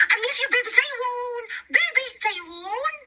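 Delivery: very high, drawn-out cooing. Real, not acted. Telling the baby she misses them, high baby talk. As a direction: squeal affection at an infant.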